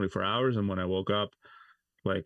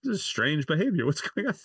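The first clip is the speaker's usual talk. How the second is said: much higher, puzzled mock; mock bewildered; 8 semitones higher than usual. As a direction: play dazed confusion. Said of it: "sitting in the car like".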